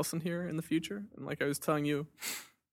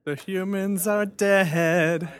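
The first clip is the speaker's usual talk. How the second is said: very slow, louder robot voice; toy robot voice; 5 semitones higher, 8 dB louder, much slower than usual. Pretend. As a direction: intone it like a menacing robot. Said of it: "I am a robot".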